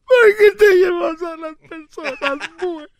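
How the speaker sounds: high, loud, slow hammed-up sobbing cry; loud mock wailing. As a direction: throw it up to the heavens, weeping. Acted widow wailing, louder.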